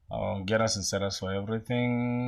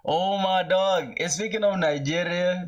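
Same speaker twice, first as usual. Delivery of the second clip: high, thrilled shout-out to a friend; excited greeting; 8 semitones higher, 3 dB louder than usual. Real, not acted. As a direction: burst out high, delighted to spot your friend. Greets Nigerian friend spotted in chat.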